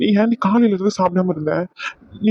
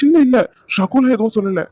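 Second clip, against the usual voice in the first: urgent denial; 3 semitones higher, 3 dB louder than usual. Acted. Husband hurriedly shouting down his wife's guess. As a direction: cut her off before she blames him.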